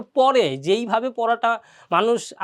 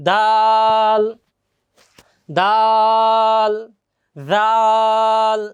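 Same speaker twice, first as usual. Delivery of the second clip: each letter held very long and louder; drawn-out letters; 6 dB louder, much slower than usual. Shown as a demonstration. Teacher drags the letters out for students to repeat. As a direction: stretch every letter slowly. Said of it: "বারবার repeat করে একটু টেনে টেনে".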